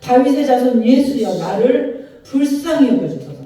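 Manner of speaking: loud desperate plea to Jesus; crying out loud. Acted. Blind beggar crying out to Jesus, loud. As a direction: shout it across the crowd. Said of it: "큰 소리를 외칩니다".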